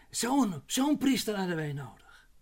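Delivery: ZO'N said twice, pitched high; stress on ZO'N. Real, not acted. Preacher drives the writer's point home, stress on ZO'N. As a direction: punch "zo'n" high, repeat it, then fall away.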